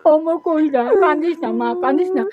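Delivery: consoling through real sobs; weeping mother's plea; tearful comforting. Real, not acted. Mother weeping, comforts crying daughter.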